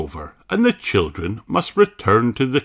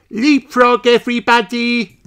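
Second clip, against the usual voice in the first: high, louder excited call; Peppa crying out; 5 dB louder than usual. A narrator's reading. Peppa calling her friends, high excited shout. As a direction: call everyone to the game. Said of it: "cries Peppa".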